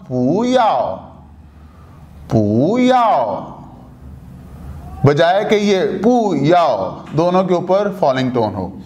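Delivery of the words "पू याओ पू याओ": पू याओ with पू rising and याओ falling; tone change demo. Shown as a demonstration. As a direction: lift पू, then let याओ fall. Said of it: "तो अब ये क्या हो जाएगा".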